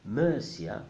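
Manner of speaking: Murcia as MER-see-a, English ur vowel; unacceptable pronunciation. Shown as a demonstration. Teacher showing the mistake, Murcia as MER-see-a. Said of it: "is not acceptable".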